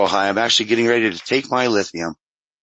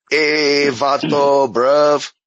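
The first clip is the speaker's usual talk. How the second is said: loud, higher mock Mexican-British accent; 6 semitones higher, 5 dB louder, much slower than usual. Shown as a demonstration. Asking if accents can blend, loud hammed-up Mexican-British mix. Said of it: "like, a Mexican accent in a British accent".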